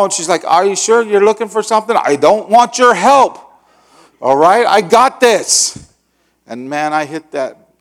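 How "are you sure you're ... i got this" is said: stubborn insistence. Retold or imitated. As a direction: insist you've got it handled. Retelling heated back-and-forth.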